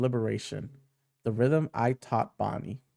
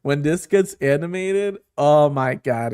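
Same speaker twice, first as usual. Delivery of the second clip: excited outburst; 3 semitones higher, 8 dB louder, slower than usual. Real, not acted. Hyped reaction to manga chapter.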